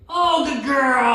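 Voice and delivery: high, loud, slow cheer; excited praise. Real, not acted. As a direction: burst out with praise for the dog. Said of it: "I scared the hell out of her".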